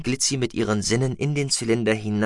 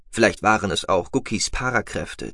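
ordinary delivery

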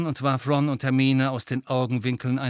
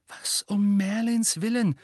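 bewildered; 10 semitones higher than usual. A narrator's reading. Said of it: "sagte Ron langsam".

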